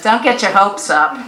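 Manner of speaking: loud know-it-all warning; smug neighborly put-down; armchair critic. Acted. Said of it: "said the know-it-all chair".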